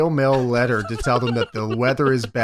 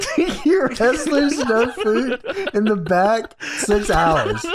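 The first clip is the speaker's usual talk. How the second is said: laughing; 9 semitones higher, much slower than usual. Retold or imitated. Repeating the wrestlers' complaints, laughing.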